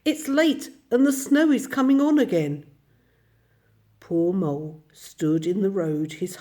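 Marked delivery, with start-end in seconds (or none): 0.0-2.7 high, loud call over the distance; urgent hail about the weather; calling back. A narrator's reading. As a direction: shout a warning back along the road. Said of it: "he called back".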